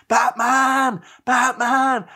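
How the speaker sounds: high, loud gig chant; crowd shouting. Retold or imitated. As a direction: holler it out like fans demanding a song. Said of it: "we were just shouting".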